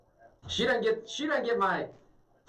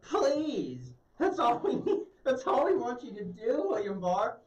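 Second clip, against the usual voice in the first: higher, whiny mock plea to indict the president; mock pleading senator; 5 semitones higher than usual. Mocking the senator, begging Barr to indict, pleading voice. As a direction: beg like an exaggerated caricature.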